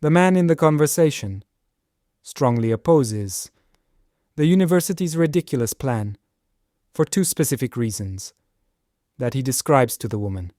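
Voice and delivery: broken into short chunks, each ending in a fall; choppy with many pauses. Shown as a demonstration. Teacher demos a disfluent reading full of pauses. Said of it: "what would happen if we add more pauses".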